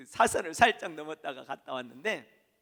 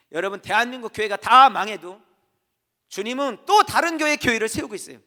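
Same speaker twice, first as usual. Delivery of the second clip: preaching; 4 semitones higher, 12 dB louder than usual. Acted.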